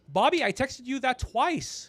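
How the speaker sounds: high, loud and slow, fed-up reminder; exasperated. Retold or imitated. To Bobby who never reads texts, exasperated. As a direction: drag it out like you've said it before.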